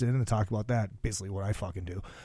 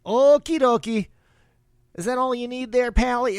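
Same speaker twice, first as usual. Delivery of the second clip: high, exaggerated Minnesotan accent; 10 semitones higher, 5 dB louder than usual. Gas station clerk to a customer, hammed-up Minnesotan accent. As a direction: put on a sing-song Minnesotan accent. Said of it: "I don't have the Minnesotan accent".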